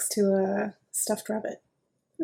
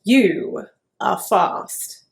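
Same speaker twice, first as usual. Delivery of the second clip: grudging chicken; 2 semitones lower, 5 dB louder, much slower than usual. Acted. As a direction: drag it out, reluctantly impressed.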